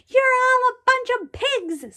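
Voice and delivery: high, slow, nasty screech; screeching Sammy. A narrator's reading. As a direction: screech it at the class like a grumpy creature. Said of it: "Sammy screeched".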